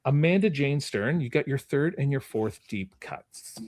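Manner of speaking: ordinary talk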